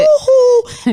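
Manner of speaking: high, loud sarcastic woohoo; mock cheer. To her friend with the seventy-two, a high, loud mock cheer. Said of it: "And I was like".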